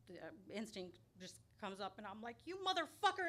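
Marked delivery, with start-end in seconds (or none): 2.5-3.3 higher, louder furious yell at a driver; retold yelling. Yelling at the driver who nearly hit her, furious. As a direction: spit it at the reckless driver. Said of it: "yelling at him".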